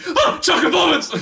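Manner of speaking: high, loud delighted shriek; excited squeal. Retold or imitated. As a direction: squeal it with pure joy. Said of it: "I wouldn't be like".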